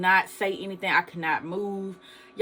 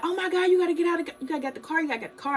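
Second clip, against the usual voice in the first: high, fast, frantic imitation of a panicked cousin; panicked scream; 9 semitones higher, much faster than usual. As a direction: mimic her frantic pleading, rushing the words. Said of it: "she was screaming like".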